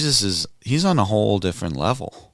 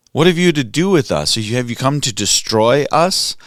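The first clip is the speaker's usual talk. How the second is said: raised, higher mock scream; 5 semitones higher, 4 dB louder than usual. Unclean spirit screams at Jesus, a raised mock cry. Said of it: "'cause he's screaming out".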